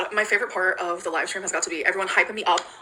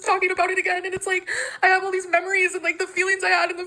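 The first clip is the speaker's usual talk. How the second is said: crying; 10 semitones higher than usual. Real, not acted. Still crying.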